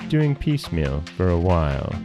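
usual speaking voice

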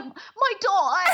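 high, drawn-out, slow mock British mum; high and slow imitating a British actress; mock British mother. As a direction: stretch it out like a weepy British mother. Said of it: "she's just like".

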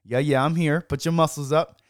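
normal talking voice

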